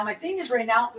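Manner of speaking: usual speaking voice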